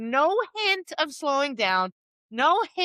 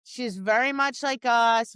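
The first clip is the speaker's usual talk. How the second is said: ordinary delivery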